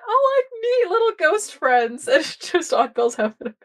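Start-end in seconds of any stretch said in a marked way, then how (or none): 0.1-2.1 very high, slow cutesy coo; very high cutesy mock delight; cooing party. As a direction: croon it like greeting pets. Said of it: "They're just like".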